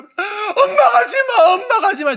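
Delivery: put-on child screaming for mother through tears; crying shout. Shown as a demonstration. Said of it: "엄마를 더 크게 불러".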